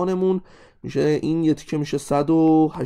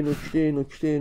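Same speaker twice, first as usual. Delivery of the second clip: ordinary talk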